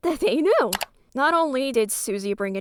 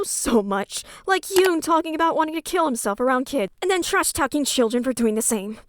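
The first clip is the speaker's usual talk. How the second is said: usual speaking voice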